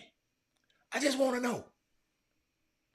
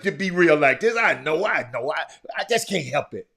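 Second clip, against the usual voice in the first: ordinary delivery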